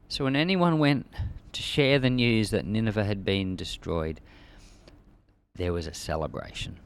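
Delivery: normal talking voice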